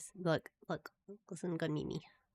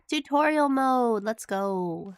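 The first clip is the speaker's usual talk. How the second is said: excited announcement; 4 semitones higher, 8 dB louder, slower than usual. Real, not acted. Starting the game tutorial.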